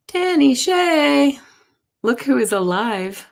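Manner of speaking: high, drawn-out call of a viewer's name; excited greeting. Real, not acted. Host spotting a returning viewer in chat, delighted drawn-out call. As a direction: stretch the name out in delighted surprise.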